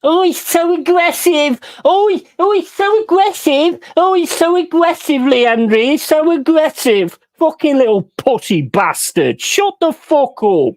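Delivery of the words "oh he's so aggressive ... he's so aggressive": high, whiny sneering mimicry; mocking the pundit. Fan mocking the TV pundit, high whiny sneer. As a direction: parody the pundit as a prissy whiner.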